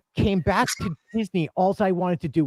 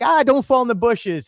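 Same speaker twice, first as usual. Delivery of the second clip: playful warning; 7 semitones higher, 4 dB louder than usual. Retold or imitated. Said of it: "I was like".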